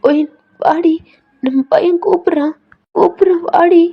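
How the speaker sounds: put-on rushing to call her, the boy is asking for her; a father shouting excitedly that their son is calling; urgent call. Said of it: "Jimin தாண்டா வெளியில ஓடியாந்து".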